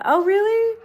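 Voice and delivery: a loud, high "Oh, really?"; surprised. Real, not acted. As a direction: jump up in surprise at the news. Reacting to a viewer's comment.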